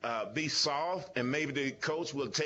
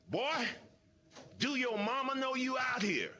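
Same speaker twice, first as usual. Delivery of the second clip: raised, put-on taunting voice of a big lineman; deep voice; 9 semitones higher than usual. Deacon Jones taunting a rookie lineman before the snap, mock menacing taunt. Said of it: "he said it in such a deep voice".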